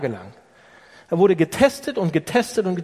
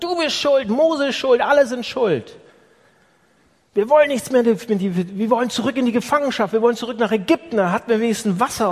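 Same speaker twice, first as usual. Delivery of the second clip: higher, louder complaining mob; angry accusation; 6 semitones higher, 3 dB louder than usual. Retold or imitated. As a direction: blame everyone at once. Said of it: "Er sagt". (das wütende Volk beschuldigt Mose, laut)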